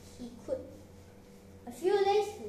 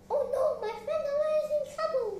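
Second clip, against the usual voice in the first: very high, fast, alarmed little mouse; panicked high mouse squeal; crying out; 13 semitones higher, 5 dB louder, much faster than usual. Acted. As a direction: cry out quickly in a squeaky high voice. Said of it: "cried the little mouse".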